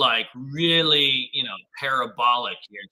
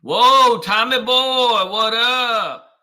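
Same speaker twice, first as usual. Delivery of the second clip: higher, louder, stretched-out whoa; light up as a buddy shows up; delighted shout-out, drawn out; excited greeting; 8 semitones higher, 7 dB louder, much slower than usual. Real, not acted.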